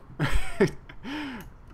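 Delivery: sudden squeaky high laugh; embarrassed high giggle. Real, not acted. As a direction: burst out in a high-pitched giggle. Laughs at denying being weeaboo.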